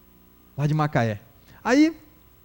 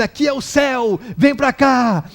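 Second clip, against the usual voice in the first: higher, louder calling out; mock megaphone shout; 4 semitones higher, 5 dB louder, slower than usual. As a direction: imitate someone yelling through a megaphone. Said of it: "com um megafone, gritando".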